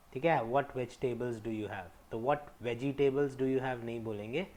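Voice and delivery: normal talking voice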